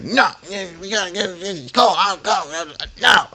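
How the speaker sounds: high-pitched mock argumentative refusal; imitated yelling. Coworker refusing to open the door, mock yelling. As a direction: cut him off in a high, agitated voice. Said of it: "keeps yelling at me".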